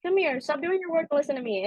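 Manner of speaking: mother pulling workers from work to gossip, high and eager; gossipy mom. Retold or imitated. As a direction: pull them in with juicy gossip. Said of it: "be like".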